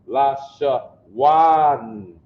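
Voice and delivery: LA, SHA, WAN with a long ah in WAN; ah before soft N. Shown as a demonstration. Teacher showing ah between the two, long drawn WAN. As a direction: stretch WAN, open ah closing softly on N. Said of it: "you still have the ah sound between".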